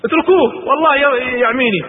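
panicked shout. Acted. Utba screams at the crowd while pinned, loud. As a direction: beg them to back off, in pain. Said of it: "فصرخ عتبة".

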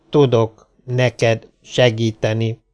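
slow, word-by-word robot voice; measured machine speech, a gap after each word; talking robot. Acted. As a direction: speak slowly like a talking machine.

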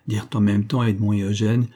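ordinary delivery

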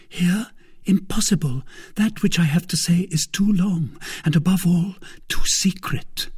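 high-pitched maid's voice, confiding; high, urgent soubrette; secretive young soubrette. A narrator's reading. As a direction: play the anxious maid with a secret, pitched well up.

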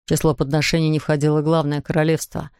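plain reading voice

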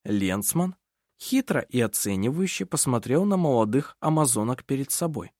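plain reading voice

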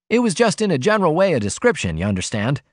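high, quick explanation from the cub; venturing. A narrator's reading. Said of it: "the cub ventured".

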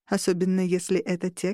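ordinary delivery

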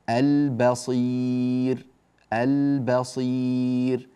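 البصير with a held ل and very long ي; chant-like slow model of البصير; clear lam demo. Shown as a demonstration. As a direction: sound the ل in البصير distinctly, stretch the ending. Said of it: "هكذا اللامات ظاهرة هنا".

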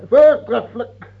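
loud high gleeful laugh on the word; cruel delight. Acted. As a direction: savour the death penalty. King delighted at death sentence. (luid en hoog van wreed genoegen)

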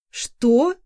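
loud startled what; shocked exclamation. A narrator's reading. Mother seeing the cat, shocked cry. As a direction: blurt it out in shock. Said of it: "потрясённо воскликнула".

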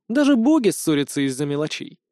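ordinary delivery, nothing marked

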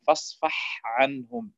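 slow فاصفح عنهم, ḥ kept separate from ayn; distinct haa. Shown as a demonstration. Teacher giving example, فاصفح عنهم with haa kept distinct. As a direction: hold the ḥ of فاصفح, then start عنهم. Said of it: "تبيينها إذا جاورها حرف".

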